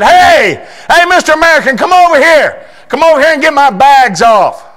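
high, loud summoning call; bossy calling. Retold or imitated. Woman at the airport calling the American over. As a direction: call out bossily across the airport. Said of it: "she said".

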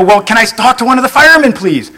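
fast, high-pitched plea; caller freaking out. Acted. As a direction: rush it, rattled and incredulous. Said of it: "the guy's freaking out".

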